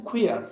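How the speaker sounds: কুয়ার said "kwi-ar", ending in an open "ar"; correct pronunciation. Shown as a demonstration. Teacher pointing out a tricky synonym, কুয়ার pronounced with final "ar". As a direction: model কুয়ার with a clear "ar" ending. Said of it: "এটা হচ্ছে কুয়ার".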